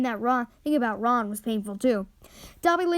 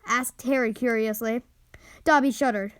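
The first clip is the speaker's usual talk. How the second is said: usual speaking voice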